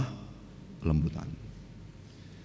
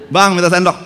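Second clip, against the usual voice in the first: loud call to the stall owner, louder and higher; wife calling the vendor for a spoon, loudly; 7 semitones higher, 11 dB louder than usual. Retold or imitated.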